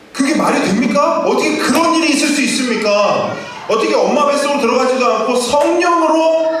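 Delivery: Nicodemus, stunned, asks Jesus how this can be; astonished Nicodemus. Retold or imitated. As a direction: gasp at it as if it makes no sense. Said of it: "깜짝 놀라서 예수님께 말하는 거예요".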